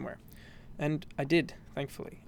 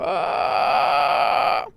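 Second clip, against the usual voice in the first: pushed throat shout; fry scream yell; loud throaty yell; 11 dB louder than usual. Shown as a demonstration. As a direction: hold the throat tension and shout. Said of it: "So you wanna go".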